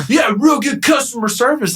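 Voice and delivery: high-pitched mocking sarcasm; retold sarcastic jab. Angry tenant sniping at broker in parking lot, sarcastic.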